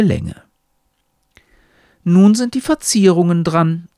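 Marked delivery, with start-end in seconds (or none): 2.0-3.9 lightly voiced talking hat, pitched up; raised, hint of a character voice; explaining hat. A narrator's reading. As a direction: announce it as the instructing hat, higher. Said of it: "erklärt Hut".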